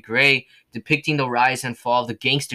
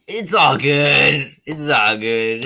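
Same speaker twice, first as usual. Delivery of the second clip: slow drawn out; 3 semitones higher, 5 dB louder, much slower than usual. Acted. Drawn-out catchphrase.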